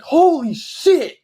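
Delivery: high, loud, drawn-out shout; retold shout. Storyteller reliving his reaction to the Nike email, shouting. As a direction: belt it out slowly, reliving the shock. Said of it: "I don't think I've ever shouted".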